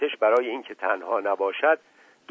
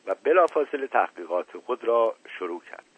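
ordinary delivery, nothing marked

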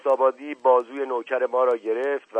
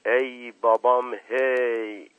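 drawn-out, slightly raised exclamation; crying out; 3 semitones higher, 4 dB louder, much slower than usual. A narrator's reading. Mash Qasem recognising the watch, crying out. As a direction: let out a long, loud "hey". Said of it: "فریاد مشقاسم به آسمان رفت".

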